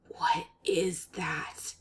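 slow, breathless horrified whisper. Acted. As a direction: whisper it in shock. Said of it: "his voice a horrified whisper".

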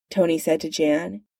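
usual speaking voice

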